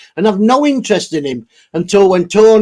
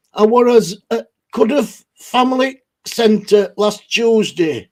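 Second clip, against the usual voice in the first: normal talking voice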